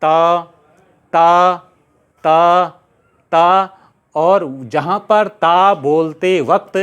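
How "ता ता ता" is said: each ता loud and held long, tongue on the teeth; dental ta drill. Shown as a demonstration. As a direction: model long, separate dental ता for repeating. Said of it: "आपको बोलना होगा ता". (ता का खींचकर दंत्य अभ्यास)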